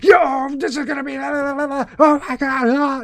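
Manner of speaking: high, loud mock-hype yelling; fake excitement. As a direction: shriek like an over-the-top hype streamer. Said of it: "the fake excitement stuff".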